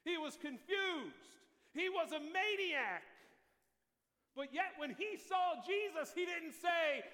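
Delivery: loud, high preaching cry; preaching at full force. Acted. Preacher crying out the madman's story to church, loud. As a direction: shout it to the back row.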